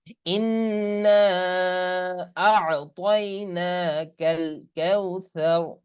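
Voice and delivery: slow melodic tajweed chanting; Quran recitation. Shown as a demonstration. Teacher reciting the surah for students, slow chant. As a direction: draw the vowels out in recitation.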